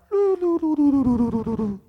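high sung doo sliding down like a dropping gauge; loud descending doo doo doo; falling meter noise. Pretend. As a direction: sing the meter sinking. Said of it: "it's like, on the faith meter level".

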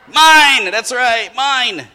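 imitating a possessive toddler; toddler's possessive cry. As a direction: blurt it like a grabby toddler. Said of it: "that word they say is".

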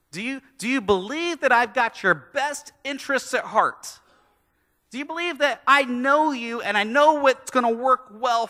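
voicing God asking us to trust; preaching plea.

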